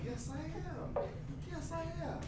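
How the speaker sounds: very high, softish answer; high-pitched reply. Retold or imitated. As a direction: answer the fan in a matching high voice. Acted reply to excited fan.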